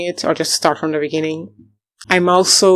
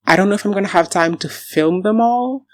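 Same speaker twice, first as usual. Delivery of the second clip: normal talking voice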